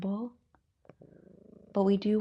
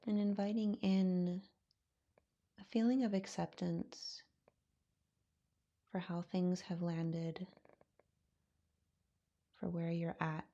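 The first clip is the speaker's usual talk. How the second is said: calm, unhurried voice with long pauses; guided meditation; 4 dB quieter than usual. Acted. Yoga teacher settling students into their breath, guided meditation. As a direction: ease them gently into stillness, softening as you go.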